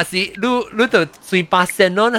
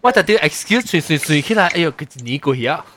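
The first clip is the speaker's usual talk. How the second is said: ordinary talk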